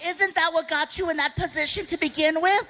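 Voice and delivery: high put-on heckling voice; audience objector. Audience member questioning the comic, high put-on voice. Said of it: "Some of you are like".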